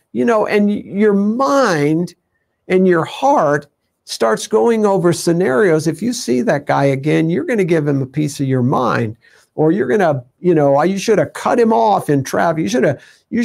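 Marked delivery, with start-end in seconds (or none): none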